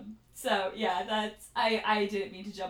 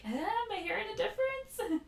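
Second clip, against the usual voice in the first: high, soft wondering to oneself; under the breath; 11 semitones higher, 8 dB quieter than usual. Retold or imitated. Podcast host testing pronunciations on herself, imitated under-breath muttering. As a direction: imitate a quiet, high-pitched self-check. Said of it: "saying it under my breath".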